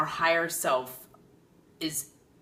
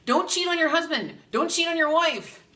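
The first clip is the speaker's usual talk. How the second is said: emphatic warning; 13 semitones higher, 8 dB louder than usual. Shown as a demonstration. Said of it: "warning the audience".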